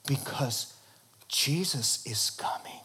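hushed, voiceless whisper, like telling a secret; secretive whisper. Retold or imitated. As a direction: lean in and whisper it. Father announces Jesus at dinner.

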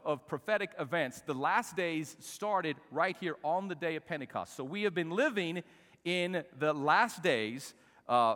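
usual speaking voice

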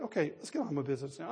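fast and soft, tossed off; brisk mock aside. Jesus moving on after healing the ear, quick and soft. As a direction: rattle it off like getting back to work.

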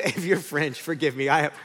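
ordinary delivery

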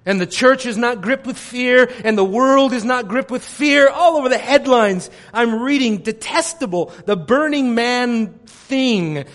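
normal talking voice

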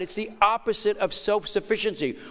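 ordinary delivery

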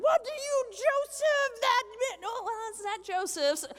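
high, loud, panicked parent voice; panicked parents hearing shocking news; freaking-out parents. Retold or imitated. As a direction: shriek it like parents losing it. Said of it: "They're, like, freaking out".

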